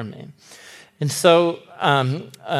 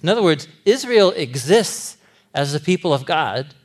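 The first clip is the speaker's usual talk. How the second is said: usual speaking voice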